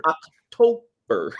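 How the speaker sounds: slow drawn-out coy answer; imitating her. Friend quoting Ivana's nipple-tweaking answer, slow and coy. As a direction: stretch the word out slowly and coyly. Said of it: "and goes".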